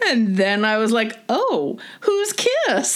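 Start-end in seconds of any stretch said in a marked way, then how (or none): 1.3-2.9 naive innocent question. Retold or imitated. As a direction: play it totally clueless and innocent. Speaker acts her naive younger self.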